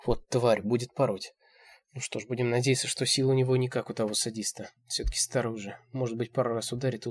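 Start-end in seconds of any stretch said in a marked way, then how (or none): none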